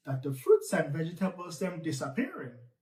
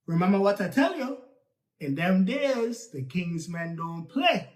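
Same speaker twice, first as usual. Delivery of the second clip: ordinary delivery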